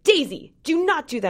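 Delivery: high, mock-scolding yell; imitating a parent's telling-off; retold yell. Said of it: "I yelled at her the other day".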